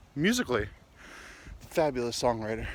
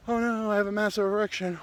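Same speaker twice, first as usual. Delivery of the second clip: higher-pitched embarrassed aside; mock dismayed confession; 7 semitones higher than usual. Turned-on guy answering his friend, mock dismay. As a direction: blurt it in a pitched-up mock worry. Acts out awkward reply to friend.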